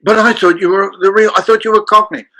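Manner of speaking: high-pitched puzzled protest; Steven Spielberg. Retold or imitated. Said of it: "And he said".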